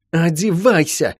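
slow, emphatic urging; insistent order. Acted. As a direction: press the order, stretching it out. Repeats the order more forcefully.

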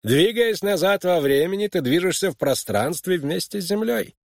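high-pitched, lecturing teacher voice; mentor's rebuttal. Acted. Mentor Yarrow correcting his pupil, high emphatic rebuttal. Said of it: "ответил на его возражение Ярроу".

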